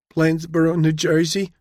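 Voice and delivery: put-on high-pitched young woman with Jersey accent; lightly voiced New Jersey accent. A narrator's reading. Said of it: "her Jersey accent obvious".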